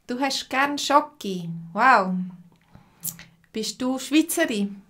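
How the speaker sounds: ordinary talk, nothing marked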